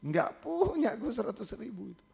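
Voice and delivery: lightly imitating a tearful student; student pleading, barely tearful; a touch of tearful. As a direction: retell the student's plea with a hint of tears. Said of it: "Sama-sama nangis".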